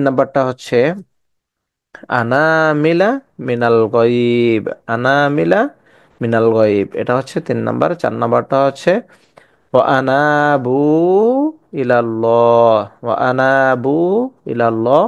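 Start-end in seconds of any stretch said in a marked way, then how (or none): 2.2-6.9 slow recitation, short ana not stretched; Quran recitation. Shown as a demonstration. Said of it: "আনাগুলাকে আমরা টানিয়া পড়তে পারবো না". 9.7-15.0 slow recitation, short ana; Quran recitation. Shown as a demonstration. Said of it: "আনাগুলাকে আমরা টানিয়া পড়তে পারবো না".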